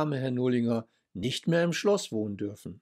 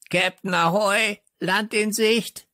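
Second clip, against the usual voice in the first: croaking parrot; 9 semitones higher, 4 dB louder than usual. A narrator's reading. Parrot calls to Captain Floh, high croaking squawk. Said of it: "krächzte Störtebeker".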